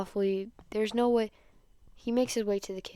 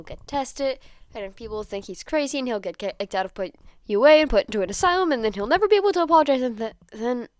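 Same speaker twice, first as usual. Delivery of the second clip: fast, rising, louder and louder rush; anxious thoughts racing faster and higher; panicked spiral; 5 semitones higher, 6 dB louder, faster than usual. Acted. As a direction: let the panic run away.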